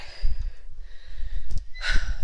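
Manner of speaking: heavy out-of-breath exhale; loud sigh to catch your breath; winded sigh. Real, not acted. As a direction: let out a big, loud puff of air. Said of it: "I'm gonna catch my breath".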